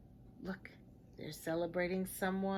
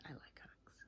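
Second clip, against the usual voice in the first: whisper; 25 dB quieter than usual. Real, not acted. Aside to the child listener.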